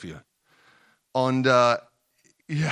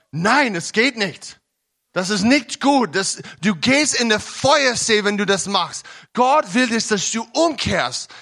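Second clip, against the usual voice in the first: higher, louder hellfire warning; fiery warning sermon; 6 semitones higher, 6 dB louder than usual. Acted. Young believer warns his roommate about sin, fiery. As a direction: warn him of the fiery lake.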